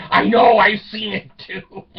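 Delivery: loud and high, tickled by it; loud excited reply. Retold or imitated. Telling his daughter he has seen it too, loud and amused. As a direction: belt it out, delighted.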